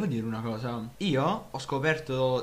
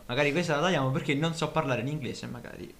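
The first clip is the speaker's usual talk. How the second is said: ordinary talk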